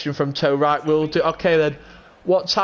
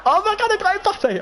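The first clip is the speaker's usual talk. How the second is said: squealing much higher, a hammed-up thrill; mock excitement. Imitating himself opening packs, hammed-up excited squeal. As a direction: act out going wild over a top pull. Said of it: "I'll be like".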